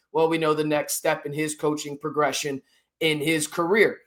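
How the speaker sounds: ordinary talk, nothing marked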